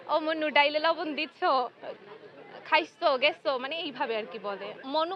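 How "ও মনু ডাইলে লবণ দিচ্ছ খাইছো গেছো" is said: calling out in Barishal dialect, louder; a Barishal aunt calling, in Barishal dialect. Retold or imitated. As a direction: hail someone affectionately in Barishal talk. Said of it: "আমাদের ওইখানে মনু দিয়ে কথা বলে".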